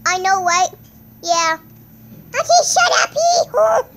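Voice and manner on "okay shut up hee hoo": much higher, loud squealing yell; loud high goofy squeal; silly high shout. Pretend. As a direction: squeal it like a cartoon.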